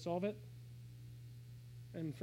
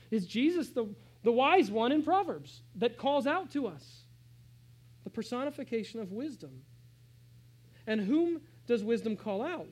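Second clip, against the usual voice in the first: preaching with force; 3 semitones higher, 7 dB louder than usual. Acted. Pastor asks congregation, forceful preaching. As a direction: drive the question home.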